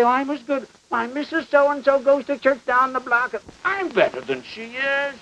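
high, soft mock self-righteousness; smug churchgoer. Preacher mimicking churchgoers who think they're as good, smug. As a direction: lift the pitch and soften, mimicking a smug comparison.

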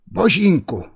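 a startled mother crying out; Božínku shouted high in alarm; mother's cry. A narrator's reading. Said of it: "vykřikla maminka".

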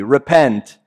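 ordinary delivery

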